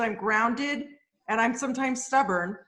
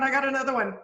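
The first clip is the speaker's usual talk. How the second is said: ordinary talk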